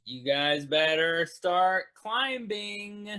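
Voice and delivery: slow, stretched call downward; drawn-out holler. Acted. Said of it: "I'm gonna holler down there".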